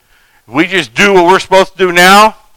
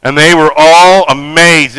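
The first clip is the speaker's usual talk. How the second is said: ordinary talk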